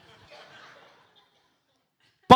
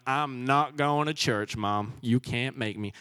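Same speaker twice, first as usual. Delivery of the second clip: put-on a childish American accent, softer and slower; sassy American accent; 5 semitones lower, 4 dB quieter than usual. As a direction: play a defiant kid in an American accent. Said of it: "Don't know why I turned American".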